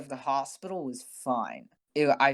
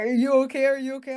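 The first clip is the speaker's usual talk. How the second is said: panicked friends; 10 semitones higher, 7 dB louder, faster than usual. Retold or imitated. Friends panicking over text.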